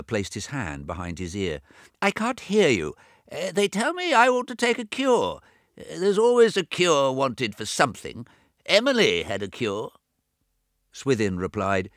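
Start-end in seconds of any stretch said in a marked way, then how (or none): 2.0-9.9 put-on high, loud old man talking over his own deafness; deaf old James. A narrator's reading. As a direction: raise the voice as if he can't hear himself. Deaf twin brother James speaks.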